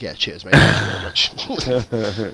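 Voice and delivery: mock gratitude, drawn out at the end; sarcastic thanks. Lapped driver to the one who passed, sarcastic thanks. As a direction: give slow, begrudging thanks. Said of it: "So it's like".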